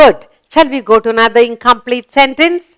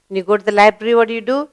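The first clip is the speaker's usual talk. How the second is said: usual speaking voice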